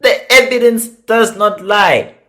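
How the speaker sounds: scolding the family. Retold or imitated. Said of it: "Which I'm like".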